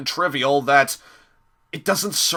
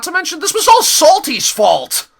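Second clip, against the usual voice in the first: high, loud burst of blame; loud, pitched-up rant; exasperated shout; 14 semitones higher, 8 dB louder than usual. Real, not acted. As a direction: yell it in indignation.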